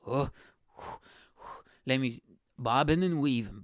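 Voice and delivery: ordinary talk, nothing marked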